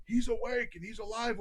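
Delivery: high-pitched, mild mock yell; retold nurse's yell. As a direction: imitate an alarmed nurse calling out, high. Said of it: "one of the nurses yelled".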